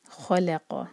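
खलक़ with heavy ख, light ल, heavy क़; recitation demo. Shown as a demonstration. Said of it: "खा heavy". (खलक़ भारी ख और क़ से)

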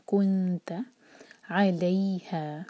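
very slow, lips rounded on कुंत then relaxed; Quran recitation. Shown as a demonstration. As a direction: draw out कुंत अलैहा. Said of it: "हमारे होंठ गोल होंगे".

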